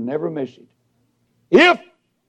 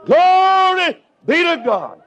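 shouted praise; 11 semitones higher, 4 dB louder, slower than usual. Real, not acted. Preacher bursts into shouted praise.

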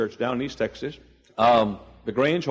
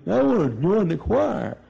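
higher, slurred, labored speech; Urban Bell; 5 semitones higher than usual. Retold or imitated. Afflicted man asking to join choir, labored imitated speech. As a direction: imitate a man who can't talk right. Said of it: "that's the way he talked".

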